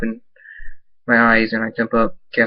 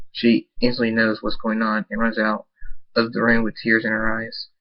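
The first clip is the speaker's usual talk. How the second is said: plain reading voice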